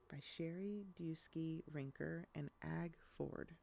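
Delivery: plain reading voice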